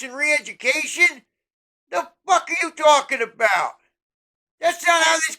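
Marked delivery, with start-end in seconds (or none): none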